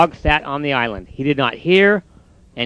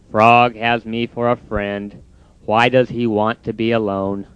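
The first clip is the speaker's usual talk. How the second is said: glum Toad; 6 semitones lower than usual. Acted. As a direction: drag out the giving up. Toad's voice.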